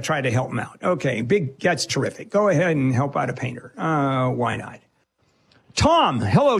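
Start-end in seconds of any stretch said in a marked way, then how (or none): 0.0-4.8 dry, low sarcasm; mock praise, sour underneath; sarcastic. Real, not acted. Host mocks caller's generosity.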